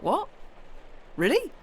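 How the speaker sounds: stunned, can't believe the offer; high incredulous really; disbelief. Retold or imitated. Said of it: "I was like".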